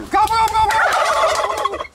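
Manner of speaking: very high, loud urging call. Real, not acted. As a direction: egg the bird on, high and loud. Urging the turkey to gobble.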